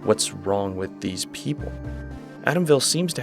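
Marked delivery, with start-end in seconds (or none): none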